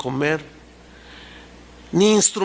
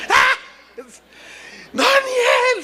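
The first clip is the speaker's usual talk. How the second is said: high laughing cry of Daniel's name; joyful shout; much slower than usual. Retold or imitated. King finds Daniel alive, high laughing shout. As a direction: burst out in laughing relief.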